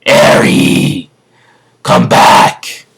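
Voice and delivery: loud voiced yell after a runaway child; angry man yelling for Eri. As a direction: shout her name across the street. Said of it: "uh, yelling".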